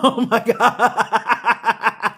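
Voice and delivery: long, high wailing laugh, words dragged out through it; cracking up. Real, not acted. Reader cracking up over the heartbroken ex, helpless laughter. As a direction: laugh helplessly through a slow exclamation, trailing into breathless chuckles.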